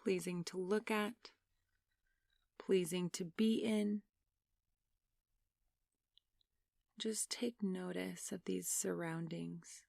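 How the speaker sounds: soft, unhurried meditation voice with long pauses; guided meditation. Real, not acted. Meditation leader asking listeners to close their eyes and breathe, soft guided meditation.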